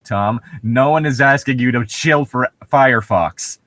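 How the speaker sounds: normal talking voice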